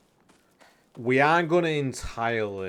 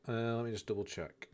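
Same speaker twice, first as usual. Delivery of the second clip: usual speaking voice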